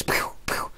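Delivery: breathy sniper shots; whispered pew pew. Retold or imitated.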